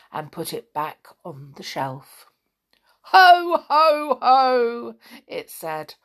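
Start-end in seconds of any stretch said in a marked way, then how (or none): 0.1-2.3 soft whispered story close; whispering. A narrator's reading. Narrator drops to hushed ending. 3.1-4.9 loud booming ho ho ho; teapot chuckle. Acted. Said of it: "with another deep chuckle".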